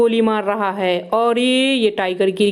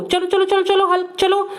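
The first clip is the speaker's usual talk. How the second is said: excited cheering on; 7 semitones higher than usual. Pretend. Urging Hulk on in toy play.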